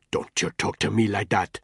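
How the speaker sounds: put-on offended, in a Nigerian accent; indignant Nigerian accent. Ntoga scolding Danny, indignant Nigerian accent. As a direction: put him in his place, Nigerian accent. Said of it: "marked Nigerian accent".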